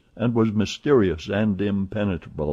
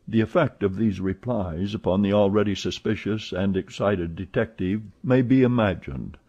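ordinary delivery, nothing marked

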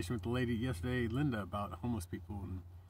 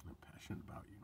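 soft whisper; 4 semitones lower, 14 dB quieter, much faster than usual. Real, not acted. Tender sign-off to viewers.

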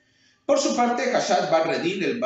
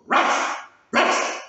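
loud barked woofs; barking dog; 11 semitones higher, 5 dB louder, much slower than usual. Acted. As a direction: bark like a huge dog. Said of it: "se puso a ladrar".

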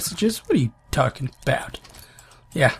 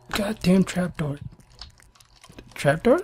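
ordinary talk, nothing marked

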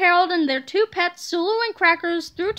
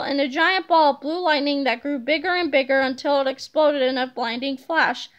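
usual speaking voice

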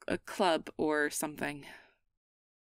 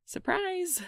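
much higher, bright reveal; surprise; 13 semitones higher, 4 dB louder than usual. Acted. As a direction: sing it out as a shy reveal.

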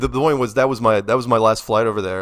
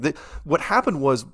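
ordinary talk

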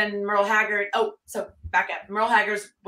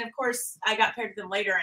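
usual speaking voice

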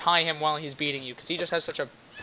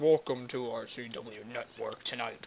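slow, deep mock show opening; imagine your voice has finally dropped and welcome the viewers; drawn-out low announcer welcome; deepened announcer voice; 3 semitones lower, 5 dB louder, slower than usual. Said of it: "that'll be, like, my real voice".

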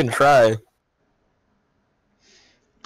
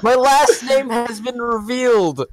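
mock dramatic announcement; 6 semitones higher than usual. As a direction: announce it like a big reveal.

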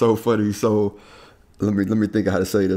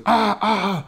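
high, excited pain cry turned to awe; a hammed-up wounded teen gasping in surprise; starstruck kid; 10 semitones higher, slower than usual. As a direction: imitate the hurt kid recognizing a celebrity. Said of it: "he looks over at me and stops and goes".

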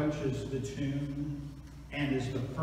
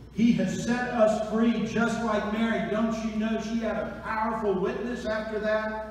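ordinary talk, nothing marked

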